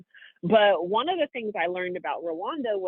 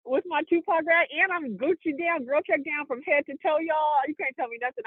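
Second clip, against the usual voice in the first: pitched up, playful bragging; mock swagger; 7 semitones higher than usual. Bragging to friends about her Tupac outfit, playful swagger. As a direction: strut it like you own the block.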